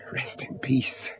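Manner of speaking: breathy whisper, barely there. Acted. Father beside his son's grave, whispering. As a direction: let the last words fall away to a whisper.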